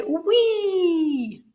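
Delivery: high, loud, long playful squeal; whee down a slide. Pretend. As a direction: cry out as if sliding down in a playground. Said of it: "you're just going down the slide".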